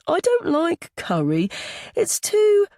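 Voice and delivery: put-on high child's voice, fussy; little boy. A narrator's reading. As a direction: object like a picky small boy. Young boy complains about food.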